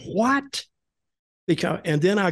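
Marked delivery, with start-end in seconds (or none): 0.0-0.6 retold astonishment. Wrestler retelling how he heard who did it, astonished. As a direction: pitch shooting well up on one word. Retold reaction to attacker's name.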